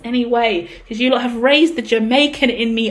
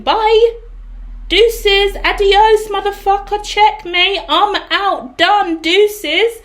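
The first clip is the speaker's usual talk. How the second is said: sassy send-off; 6 semitones higher, much slower than usual. Acted. Sassy dismissal of the querent.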